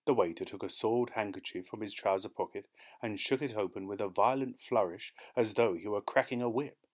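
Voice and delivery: usual speaking voice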